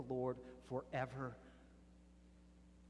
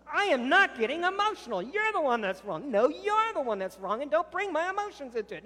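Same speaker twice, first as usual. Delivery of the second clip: much higher and much louder, near shouting; yelling in a fight; 13 semitones higher, 13 dB louder than usual. Acted. Spouses shouting over each other at the climax, full yell. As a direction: lose your temper completely. Argument at its peak.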